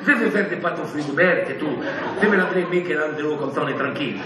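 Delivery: lightly voiced Italian painter, low; hint of an Italian accent, dropping low. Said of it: "il est italien".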